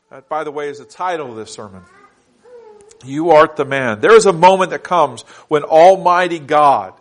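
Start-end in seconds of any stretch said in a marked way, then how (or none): 3.1-6.9 loud, ringing pulpit declaration; full-force preaching. Acted. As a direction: proclaim it to the whole hall.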